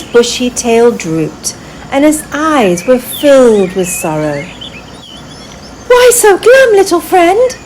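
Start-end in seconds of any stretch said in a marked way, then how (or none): 5.9-7.6 high, light fairy voice, gently asking; a tiny fairy coaxing a sad friend; high, bright fairy asking. A narrator's reading. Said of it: "Lyria asked".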